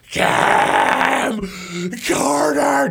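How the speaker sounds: drawn-out announcer roar, loud and high; Bruce Buffer announcer. Shown as a demonstration. Co-host showing an announcer how to stretch names, roaring. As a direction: belt out the name like a fight announcer. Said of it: "watch him, and that's where you go".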